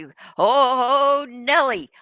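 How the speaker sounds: hammed-up crash call like an old derby announcer; Dick Lane call. Host echoing the derby announcer at a crash, mock excited. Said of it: "I remember Dick Lane saying that".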